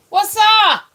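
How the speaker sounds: high, loud greeting shout; playful loud hello; loud high call-out. Real, not acted. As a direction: call out to the room. Calling out to watchers.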